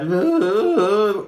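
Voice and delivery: high, drawn-out wounded animal moan; wounded animal sound. Shown as a demonstration. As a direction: let out a cracked wounded-animal wail. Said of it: "wounded animal sound".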